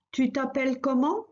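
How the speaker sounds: "Tu t'appelles comment?" spoken clearly, with a rising tune on "comment"; teacher's model, the question rising at the end; model phrase, rising question. Shown as a demonstration. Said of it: "Let's repeat it a few times".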